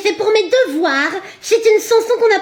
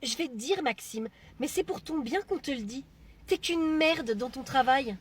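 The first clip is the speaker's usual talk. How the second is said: hushed taunt; 6 semitones lower, 15 dB quieter than usual. Acted. Colleague whispers an insult to Maxime, low and hushed.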